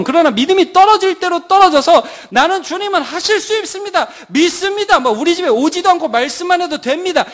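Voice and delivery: high, loud full-force sermon; fervent preaching. Acted. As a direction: preach it hard to the congregation. (높고 크게 열정적으로 설교하기)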